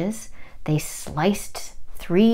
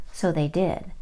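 ordinary delivery

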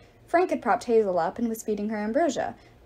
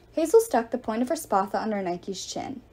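plain reading voice

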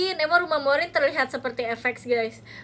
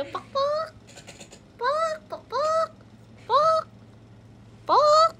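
very high clucks, growing louder; chicken clucks; 6 dB louder, much slower than usual. Retold or imitated. Vlogger repeating her chicken clucks for her friend. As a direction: cluck harder and harder like a hen. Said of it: "Suara ayam kan".

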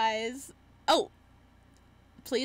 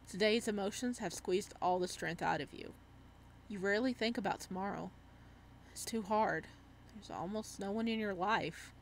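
story narration; 3 semitones lower, 5 dB quieter than usual. A narrator's reading. Reads the game's story text.

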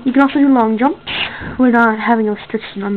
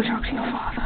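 whisper; 12 dB quieter than usual. Acted. Hushed aside to Lucy.

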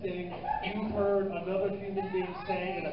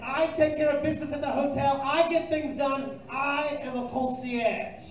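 higher, very slow, self-important concierge; 6 semitones higher, slower than usual. Retold or imitated. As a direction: lay it on like a grand concierge. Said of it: "He said".

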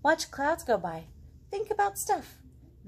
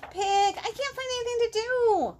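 whiny frog; 14 semitones higher, 6 dB louder than usual. Acted. Frog asks pig.

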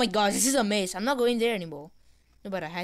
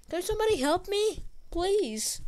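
much higher, begging whine; pleading for help; 9 semitones higher than usual. Real, not acted. Stuck player begging teammates for help, high whine. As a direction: whine high for someone to rescue you.

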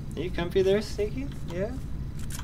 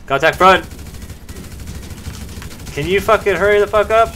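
loud, high shout; shouting over gunfire; 9 semitones higher, 8 dB louder than usual. Real, not acted. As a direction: yell over the shooting. Under fire, waiting for helicopter.

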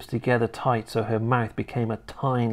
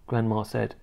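plain reading voice

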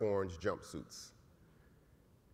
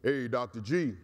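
a big man's booming call from behind; 3 dB louder than usual. Retold or imitated. Big brother calling Doctor G back across the cafeteria, booming. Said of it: "I heard a booming voice behind me".

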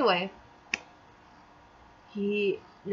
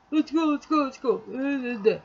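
higher, repetitive mock hurrying; impatient urging imitation; 4 semitones higher than usual. Imitating him hurrying her to the cash, impatient. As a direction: badger someone to move, in mockery.

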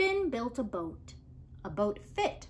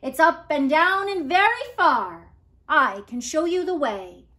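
loud, strange-sounding fish voice; a grand fish voice calling out; a lightly voiced giant fish offering to guide Finn; booming fish voice; 3 semitones higher, 9 dB louder than usual. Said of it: "in a voice that made Finn's boat shake".

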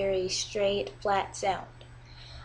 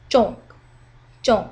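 "zhong" falling from high to low; 9 dB louder than usual. Shown as a demonstration. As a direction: drop "zhong" sharply in pitch. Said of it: "It goes from high to low".

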